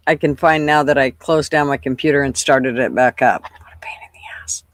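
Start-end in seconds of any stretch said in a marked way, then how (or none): 3.4-4.6 whispered grumble. Real, not acted. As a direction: whisper it in frustration. Muttering frustrated at computer.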